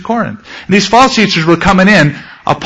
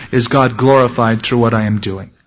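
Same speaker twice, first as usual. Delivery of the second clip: ordinary talk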